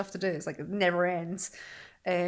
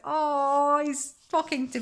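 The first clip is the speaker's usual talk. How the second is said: higher, louder, touched gasp; delighted coo; 7 semitones higher, 6 dB louder, slower than usual. Retold or imitated. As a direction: melt at your toddler chatting. Delighted quote about son.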